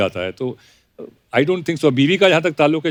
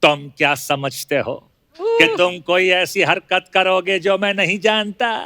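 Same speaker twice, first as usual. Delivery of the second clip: slow, menacing film-villain father; Pran's measured, threatening dialogue; Pran impression; 3 semitones higher, slower than usual. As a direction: drag each word out like a cold old screen villain. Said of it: "एक normal प्राण साहब हैं".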